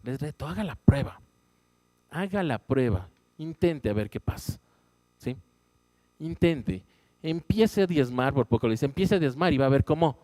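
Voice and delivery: predicación a todo pulmón, más alta y fuerte; preaching at full force. Acted. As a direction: exhorta a la congregación con fuerza. Preaching the tithe challenge. (predicador desafiando a la iglesia a diezmar, con fervor)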